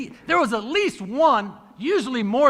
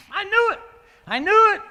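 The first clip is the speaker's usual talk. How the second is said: excited I knew it; 9 semitones higher, 4 dB louder than usual. Retold or imitated. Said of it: "I'm like".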